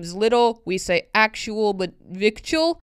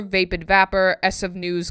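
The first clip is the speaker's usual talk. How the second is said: ordinary talk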